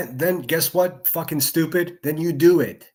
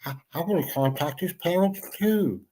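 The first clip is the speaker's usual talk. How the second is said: mocking sleepy voice; 4 dB quieter than usual. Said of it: "old droopy motherfucker gonna wake up and say".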